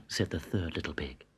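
plain reading voice

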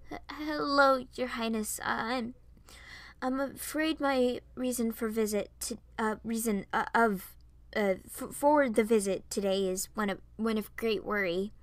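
halting, tripping over the words with ums and restarts; flustered, formal and anxious; anxious stuttering. Acted. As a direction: nervously stumble and restart over your words. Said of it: "I was stuttering and messing up".

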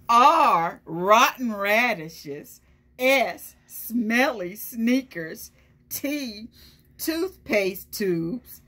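lightly voiced hungry truck savouring its menu; greedy truck. Truck reciting its recipe to the kids, lightly relishing. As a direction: relish each gross item like a treat.

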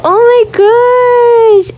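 high, slow, drawn-out oh my gosh; high delighted drawl; awed. Real, not acted. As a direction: swoon over the view. Admiring pool and jacuzzi.

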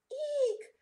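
small, very high squeak of alarm; restrained storybook screech. A narrator's reading. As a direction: squeal it without volume. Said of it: "Rocky screeched".

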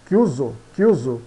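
CHIUSO with a hard K, "KYOO-zo"; Italian pronunciation. Shown as a demonstration. Teacher models Italian, CH in chiuso as K. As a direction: open chiuso with K. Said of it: "São pronunciados como ki".

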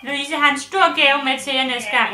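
high, sing-song pet talk; baby talk to a pet. Acted. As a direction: coo at the dog.

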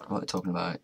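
usual speaking voice

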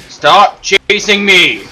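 loud, high, drawn-out yell at a pursuer; frantic yelling while being chased; shouting at enemy. Real, not acted. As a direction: yell at him to back off.

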